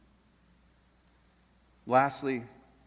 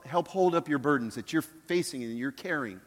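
usual speaking voice

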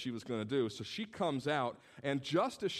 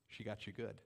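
amused quiet aside; 4 semitones lower, 9 dB quieter than usual. Retold or imitated. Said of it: "He said".

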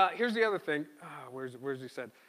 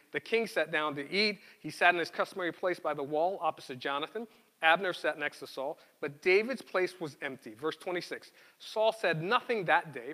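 louder, measured Bible reading voice; scripture reading; 5 dB louder than usual. Acted. Pastor reading Samuel aloud to the congregation, louder read-aloud. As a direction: read the passage out to the church.

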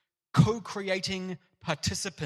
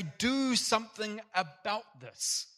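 higher, slow pleading cry; 6 semitones higher, slower than usual. Retold or imitated. Person crying out to God over injustice, slow high plea. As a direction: plead "Do something about this" high and slow, punching "Do". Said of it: "we cry out and say".

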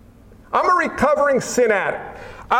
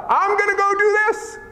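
high, bright-eyed go-getter voice, raised well up; cocky self-will; 10 semitones higher than usual. Retold or imitated. Preacher mimicking a believer running ahead of God, high and eager. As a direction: brush God off and charge ahead, pitched high.

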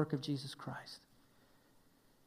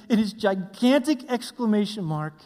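ordinary delivery

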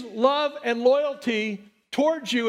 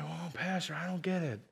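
much softer whiny confused voice; mock confused congregant; 6 semitones lower, 13 dB quieter than usual. Pastor voices a puzzled congregant, hushed whine. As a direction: play a lost listener, hushed. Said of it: "And you might".

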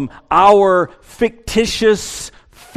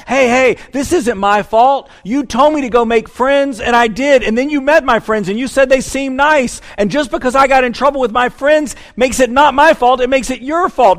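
put-on higher and louder, a defensive teen protesting; whiny teenager; 7 semitones higher, 3 dB louder than usual. Teenager whining at parents, higher and louder. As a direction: play the teen blaming their parents. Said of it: "just like a teenager that says to their parents".

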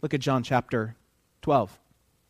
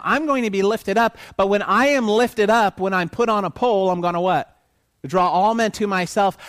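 louder, higher, pleading passion; fervent preaching; 5 semitones higher, 5 dB louder than usual. Acted. Preacher voices Jesus promising to draw all men, loud and high. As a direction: lift it up with fire.

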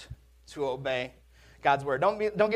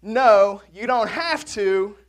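loud, slow fatherly correction; dad's stock reply; 3 semitones higher, 7 dB louder, slower than usual. Retold or imitated. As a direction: lay it down like a stern dad. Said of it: "he would say".

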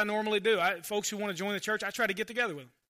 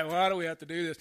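lowered mock complaint; put-on grumbly whine, pitched down; sighing complaint; 4 semitones lower than usual. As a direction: imitate a weary, reluctant moaner. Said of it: "it's a collective sigh".

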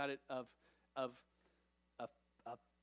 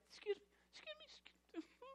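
tiny high whispery voice, much softer; timid squeak; 10 dB quieter than usual. Retold or imitated. Pastor mocking timid believers who apologize for their faith, tiny squeak. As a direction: squeak it out, afraid to bother anyone. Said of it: "we don't want to get in the way".

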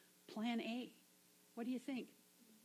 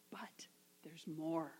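slow secret whisper; whispered teaser of more to come; 5 semitones lower, 6 dB quieter, slower than usual. Retold or imitated. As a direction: lean in and hush it like a secret. God's hushed aside in retelling.

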